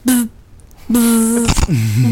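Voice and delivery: drawn-out insect-like buzz; imitated buzzing. Imitating the kid flapping his arms like wings, buzzing. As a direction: buzz like a flying bug. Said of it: "flapping his arms like wings, going".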